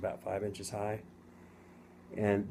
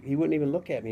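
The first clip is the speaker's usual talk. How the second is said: ordinary talk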